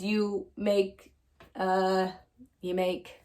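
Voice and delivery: hesitant, halting, fading out; searching for the word, then giving up; trailing off. A narrator's reading. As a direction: stall and let it trail away. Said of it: "His voice trailed off".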